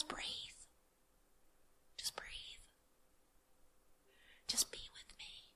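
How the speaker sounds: slow, gentle whisper; calm whisper. Retold or imitated. God calming her anxious prayer in a tender whisper. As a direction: soothe her like a loving parent. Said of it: "his voice is just, just calm".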